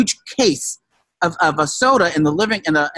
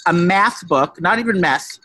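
normal talking voice

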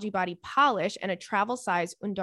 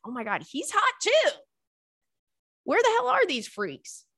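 excited gush; 10 semitones higher than usual. Retold or imitated. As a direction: voice jumping much higher in mock disbelief. Gushing over hot actor.

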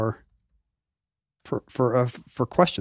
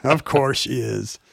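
slow, resigned shrug of a line; mock resigned; slower than usual. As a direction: say it slowly, as if nothing can be done. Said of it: "you sound resigned to it".

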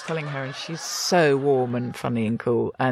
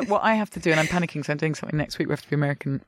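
usual speaking voice

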